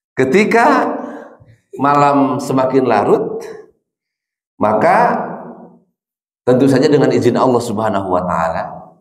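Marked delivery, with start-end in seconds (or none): none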